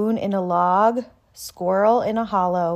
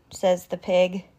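ordinary delivery, nothing marked

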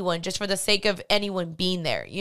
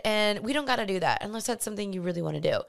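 normal talking voice

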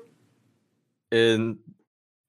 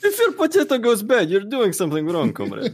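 hammed-up Russian accent, much higher; 10 semitones higher than usual. Host scolds players over potatoes, comic Russian accent. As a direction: lecture the comrade in a comic Russian voice. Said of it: "I do my Russian accent".

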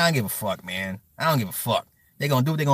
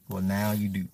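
lower, softer deadpan repeat; low aside; 6 semitones lower, 7 dB quieter than usual. Real, not acted. Streamer answering a surprised listener, low aside. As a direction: drop it down, softly matter-of-fact.

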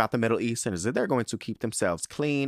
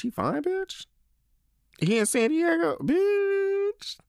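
squealing hype; slower than usual. Real, not acted. Host shrieking over the listener's fine man. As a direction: shriek with delight at the news.

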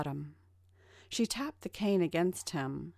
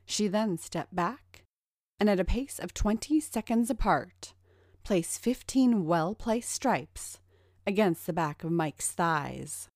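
ordinary delivery, nothing marked

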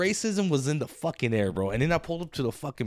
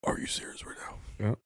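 whisper; 7 semitones lower, 10 dB quieter than usual. Real, not acted. Whispered back to friend.

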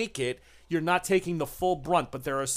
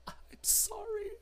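high creature whimper; 11 dB quieter than usual. Acted. The creature fearfully whimpers about Arturo, very high and soft. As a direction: squeak out a frightened apology. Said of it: "GM voices creature".

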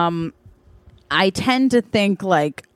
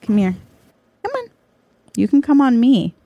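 high, coaxing pet-calling voice; 5 semitones higher, much faster than usual. Real, not acted. Coaxing the dog to come over, high and sweet. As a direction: lift the voice to coax a dog over.

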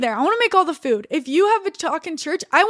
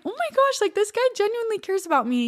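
much higher, breathless oh my gosh; recalling the Dutch boyfriend's kindness, higher and amazed; amazed delight; 7 semitones higher, 4 dB quieter than usual. Retold or imitated. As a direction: melt at being accepted. Retold joy at boyfriend's words.

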